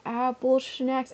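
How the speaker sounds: high, stretched-out slow-mo imitation; slow-motion voice. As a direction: drag it out like a slowed-down clip. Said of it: "me in slow-mo being like".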